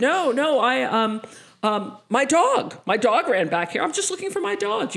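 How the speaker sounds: high, loud flustered babbling; nervous excuse. Retold or imitated. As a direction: scramble for an excuse, rattled.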